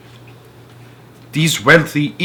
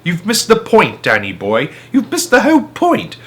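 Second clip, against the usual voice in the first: higher, louder insistent dad voice; lively dad; 8 semitones higher, 3 dB louder than usual. A narrator's reading. Dad teasing his son about missing the point, insistent. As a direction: press the point, grinning.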